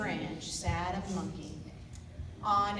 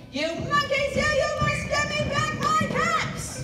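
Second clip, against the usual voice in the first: much higher and louder, a full yell; furious shout; 8 dB louder than usual. Acted. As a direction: scream at the monkeys at full force. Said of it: "stamped both his feet and shouted".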